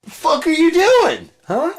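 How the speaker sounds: high, loud, incredulous yell. Retold or imitated. Confronting someone ridiculous, a high loud yell. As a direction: shout it in disbelief. Repeated punchline.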